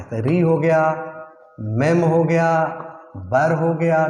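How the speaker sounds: "री", "मेम", "बर" as three separate slow beats; syllable by syllable. Shown as a demonstration. Teacher showing the word splits in three parts, syllable by syllable with "री", "मेम", "बर" set apart. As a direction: split out "री", "मेम", "बर", pausing after each part. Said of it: "ये तीन part में बट रहा है".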